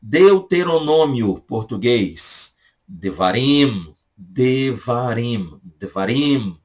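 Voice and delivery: Devarim spoken very slowly, de-va-rim; slow it down so learners catch Devarim; very slow teaching pronunciation. Shown as a demonstration. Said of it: "Fala mais devagar pra você poder pegar a pronúncia".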